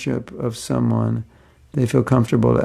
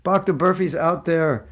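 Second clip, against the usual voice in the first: ordinary delivery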